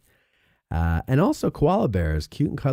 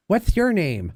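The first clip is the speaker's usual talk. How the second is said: mock nerd; 11 semitones higher, slower than usual. Host mocking nerds introducing themselves, high caricature voice. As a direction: pitch it up like a hammy fantasy nerd. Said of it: "Why do nerds love the name".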